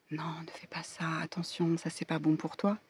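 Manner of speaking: soft cautionary inner voice; warning whisper. Acted. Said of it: "une partie de vous qui doit dire". (chuchotement d'avertissement)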